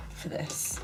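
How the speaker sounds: whispered aside. Real, not acted. Soft aside.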